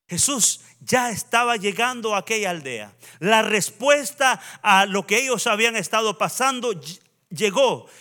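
loud, emphatic pulpit delivery; forceful sermon proclamation; preaching. Real, not acted. Preaches with raised voice.

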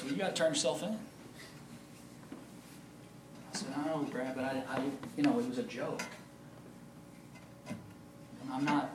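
talking quietly about confessing; hushed talk. Retold or imitated.

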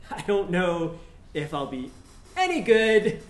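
usual speaking voice

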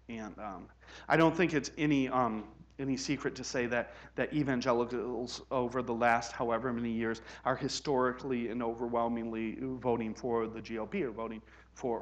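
normal talking voice

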